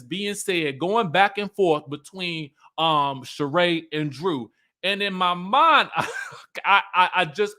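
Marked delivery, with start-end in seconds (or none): 5.9-6.6 burst of laughter. Real, not acted. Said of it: "I fell out laughing".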